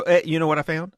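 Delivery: normal talking voice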